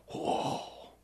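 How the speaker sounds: long, airy "whoa"; acted astonishment. Eighty priests seeing the leprosy appear, acted astonishment. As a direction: breathe out a stunned whoa. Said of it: "eighty guys suddenly go".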